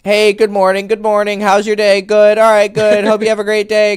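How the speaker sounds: bright, loud, friendly greeting voice; chirpy good-morning voice; cheery polite greeter. Retold or imitated. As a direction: play the overly nice neighbor. Mock cheerful greeting to passerby.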